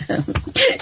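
high burst of laughter. Real, not acted. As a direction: crack up at your own slip. Cracks up at own tongue slip.

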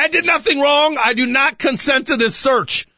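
high-pitched declaration of innocence; mock indignant protest. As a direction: protest it high, slowing into a formal refusal. Acted protest to a police officer.

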